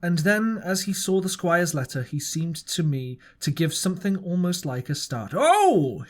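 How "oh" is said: a loud, high, startled "Oh!"; jolted into a sudden exclamation. A narrator's reading. Silver startled at reading the letter, loud exclamation. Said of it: "he said quite loud".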